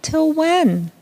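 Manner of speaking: dziecięce, zniecierpliwione pytanie; impatient children. Acted.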